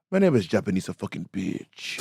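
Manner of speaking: imitated drag queen's Japanese accent; thick Japanese accent. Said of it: "she had a, a very Japanese accent".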